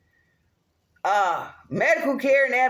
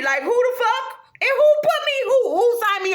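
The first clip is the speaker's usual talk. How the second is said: much higher, louder indignant yelp; outraged outburst; 11 semitones higher, 4 dB louder than usual. Real, not acted. Ranting at whoever signed her up, outraged shriek. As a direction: spike up in disbelief.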